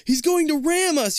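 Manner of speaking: high, loud terrified shout; panicked shout. Acted. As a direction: scream the warning. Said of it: "you cry".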